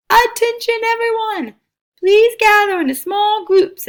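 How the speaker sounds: calling out. A narrator's reading. Circumference addresses the crowd.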